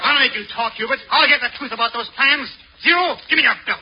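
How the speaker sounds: loud angry threat; angry shout. Acted. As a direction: bark out the threat. Threatening Hubert for plans.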